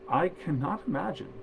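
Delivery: ordinary delivery, nothing marked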